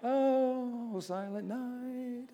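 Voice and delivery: high, slow, sugary sing-song; mock sweet carol. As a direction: coo it like a gentle Christmas carol. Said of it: "It wasn't like".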